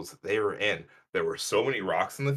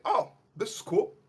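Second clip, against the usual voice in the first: higher, lightly excited retold gasp of wonder; imitating excitement; 11 semitones higher than usual. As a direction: replay the thrill of the find, a bit higher. Retold finding a marble foot.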